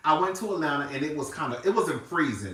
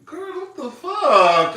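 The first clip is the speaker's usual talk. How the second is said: higher, louder and slow; loud mock disbelief; 12 semitones higher, 8 dB louder, much slower than usual. Complaining to viewers about the shedding coat, loud slow outrage. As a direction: punch it out in outrage.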